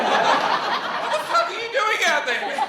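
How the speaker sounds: high, loud, slow panicked shriek; laughing fake-scared outburst; mock panicked yell. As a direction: yell it in hammed-up terror. Retelling own panicked reaction.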